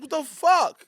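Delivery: high, loud mock shock; shocked outcry. Man to the cat that hit his arm, shocked. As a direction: yelp it in startled outrage.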